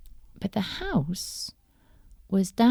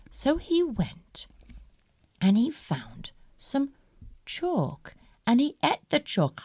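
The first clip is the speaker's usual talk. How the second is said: ordinary delivery, nothing marked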